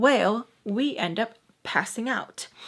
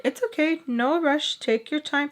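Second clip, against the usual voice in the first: higher-pitched, kindly retold voice; reassuring mom; 6 semitones higher than usual. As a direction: imitate a mom saying no hurry. Mom reassures, Sam contradicts.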